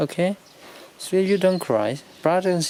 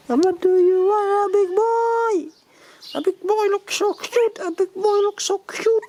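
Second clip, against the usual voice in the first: much higher, cooing pet voice. Real, not acted. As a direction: coo up high like talking to a puppy. Cooing at the animals.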